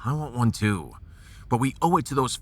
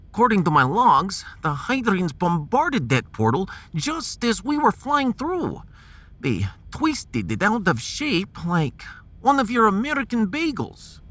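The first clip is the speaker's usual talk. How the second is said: put-on a higher-pitched Russian-accented scientist, patient and precise; 7 semitones higher than usual. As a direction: play the Russian science officer calmly explaining the stars. Russian officer explains the wormhole.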